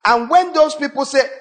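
ordinary talk, nothing marked

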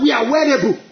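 high-pitched, emphatic bold quote; fearless declaration. Retold or imitated. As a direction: proclaim it like a fearless warrior. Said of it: "who speaks like that?".

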